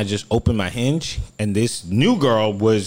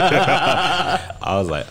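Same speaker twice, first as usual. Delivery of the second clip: laughing; 6 semitones higher, much slower than usual. Real, not acted. Laughing through his retelling.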